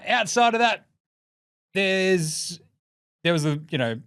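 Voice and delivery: normal talking voice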